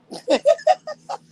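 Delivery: sudden high laugh bursting out; high burst of laughter. Real, not acted. Laughing at her own story, a high burst.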